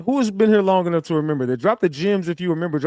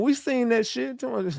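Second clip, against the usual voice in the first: ordinary talk, nothing marked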